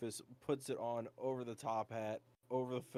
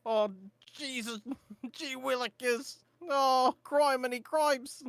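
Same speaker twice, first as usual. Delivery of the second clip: high, slow mock-pious cursing; higher and louder with each oath; hammed-up panic; 12 semitones higher, 6 dB louder, slower than usual. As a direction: gasp out old-timey oaths in high panic. Rufus thrashing, cursing in panic.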